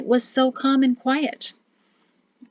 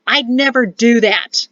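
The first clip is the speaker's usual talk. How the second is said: raised voice, shouting back; 3 semitones higher, 6 dB louder than usual. A narrator's reading. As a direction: shout it in protest. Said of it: "ended with Perrin shouting".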